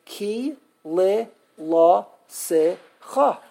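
KI, LE, LA, SE, CHA with gaps between; syllable by syllable. Shown as a demonstration. Teacher who used to teach this, separate syllables. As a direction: count out each syllable on its own. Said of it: "There are five syllables".

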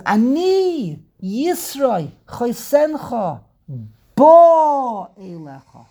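loud, high, slowly stretched declaration; proclaimed introduction. Acted. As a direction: announce yourself grandly, holding each word. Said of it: "he said".

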